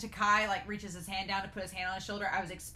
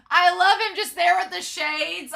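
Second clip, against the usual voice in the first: ordinary talk, nothing marked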